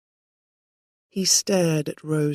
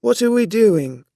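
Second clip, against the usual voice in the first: put-on drunk man; 3 semitones higher, 9 dB louder than usual. A narrator's reading. Drunk man confused, calling out.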